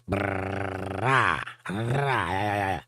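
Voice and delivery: long rolled r trill; rolled r exercise. Shown as a demonstration. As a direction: trill the tongue, brrrah. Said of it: "roll your tongue like that".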